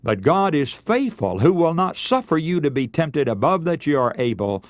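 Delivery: ordinary talk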